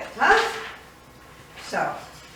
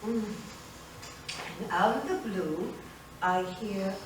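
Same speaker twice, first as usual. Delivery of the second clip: usual speaking voice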